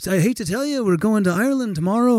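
put-on apologetic star voice; lilting musician impression. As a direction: let a fan down politely. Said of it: "And he's like".

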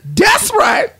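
very high squealed agreement; cartoonish high-pitched dude; Hungry Dude. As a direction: chime in with an excited falsetto. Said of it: "now Hungry Dude is".

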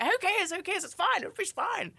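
very high-pitched coaxing; acted insistent persuasion. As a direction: wave his worries away, insistently. Acting out persuading reluctant date.

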